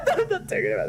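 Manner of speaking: embarrassed laughing protest; laughing refusal. Real, not acted. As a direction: giggle out the refusal as you repeat it.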